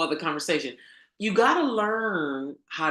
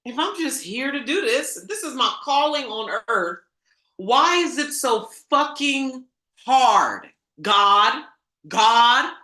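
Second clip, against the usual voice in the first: high, loud, exasperated shouting; a loud, exasperated cry of why is it so hard; frustrated outcry; 6 semitones higher, 7 dB louder than usual. Retold or imitated. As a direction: vent the struggle to the heavens. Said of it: "y'all that have been wondering".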